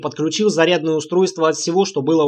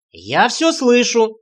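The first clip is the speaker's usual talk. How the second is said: called out from the next room, slowly; from another room; 6 semitones higher, much slower than usual. A narrator's reading. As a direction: shout it from offstage. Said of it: "крикнула из соседней комнаты Света".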